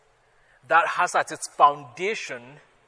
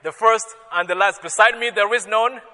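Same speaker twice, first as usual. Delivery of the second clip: hammed-up, louder and higher self-boast; mock boasting; 5 semitones higher, 7 dB louder than usual. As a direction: puff yourself up as a joke. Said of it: "If I ever say".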